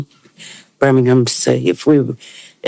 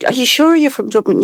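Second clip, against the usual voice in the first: higher, doubting imitation of pub boys; mock disbelief; 11 semitones higher than usual. Imitating pub boys who doubt he's from Dublin, mock disbelief. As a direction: push it, unconvinced. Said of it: "they'd say".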